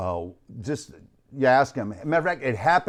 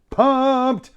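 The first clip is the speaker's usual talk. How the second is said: high, loud, drawn-out cry of excitement; hyped-up pumped; 14 semitones higher, 7 dB louder than usual. Acted. Host reading a viewer's chat comment, hyped up. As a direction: belt it out high like a hyped fan.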